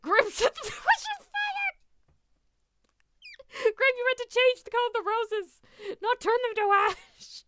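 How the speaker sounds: high, loud shout through real laughter; laughing shriek. Real, not acted. Streamer scolding Grim over burnt roses, shrieking with laughter. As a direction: crack up while scolding, drawing out the words. Reacting hysterically to a game mishap.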